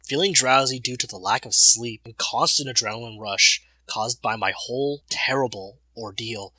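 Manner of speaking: usual speaking voice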